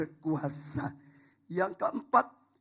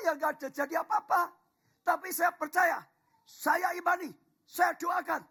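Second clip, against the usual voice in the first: rising, loud proclamation, higher and louder; full-force preaching; 11 semitones higher, 4 dB louder than usual. Acted. Pastor to the congregation, proclaiming at full force. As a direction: preach it out to the whole church. Preacher listing his vision.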